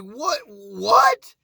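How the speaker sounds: freaking out. Retold or imitated. Pit boss freaking out over the lucky ace, rising shriek. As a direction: build from a gasp to a shriek. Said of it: "I'm like".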